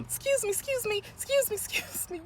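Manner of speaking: high-pitched, lightly laughing plea to get through; mock urgent excuse-me. Woman pushing through the line behind her, mock urgent excuse-me. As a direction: imitate squeezing politely through a crowd, voice up high.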